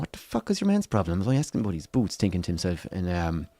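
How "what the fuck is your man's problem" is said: miffed, higher but under the breath; soft, mildly offended mutter; half pissed off. Retold or imitated. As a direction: grumble it to yourself, quietly indignant. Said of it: "kinda getting a little half pissed off".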